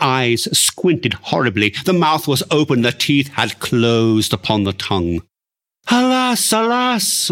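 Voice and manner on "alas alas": wailing alas, pitched high. Acted. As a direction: cry it out in a high wail. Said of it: "wailed the woman".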